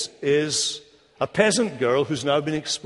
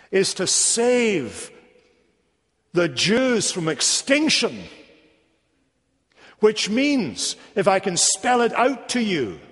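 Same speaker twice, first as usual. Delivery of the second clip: higher, slow, building to a climax; preacher building fervour; rising emphasis; 6 semitones higher, slower than usual. Real, not acted. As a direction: press each word home slowly. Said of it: "climax of sermon point".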